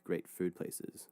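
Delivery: usual speaking voice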